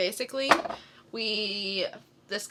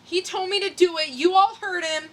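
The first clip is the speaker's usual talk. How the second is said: much higher, loud and fast; frantic self-justifying outburst; panicked rant; 10 semitones higher, 7 dB louder than usual. Acted. Rookie ranting after knocking out a tooth, loud.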